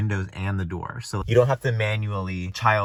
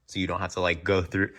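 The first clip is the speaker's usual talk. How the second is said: ordinary talk, nothing marked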